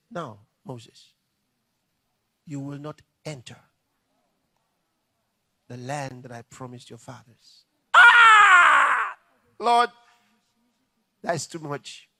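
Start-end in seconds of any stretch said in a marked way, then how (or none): 0.1-7.7 low, soft verdict; solemn God. Retold or imitated. God pronouncing judgment on Moses, low and quiet. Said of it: "God said". 7.9-9.9 loud, high wail of despair; anguished cry. Retold or imitated. Moses crying out to God on hearing the verdict.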